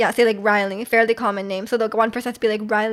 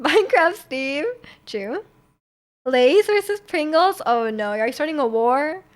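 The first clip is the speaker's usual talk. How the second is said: ordinary talk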